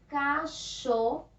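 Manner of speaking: CACHORRO drawn out syllable by syllable with a strong, breathed R like an English h; teacher slowly sounding out "Cachorro" with the strong R; strong double R. Shown as a demonstration. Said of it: "pronúncia forte".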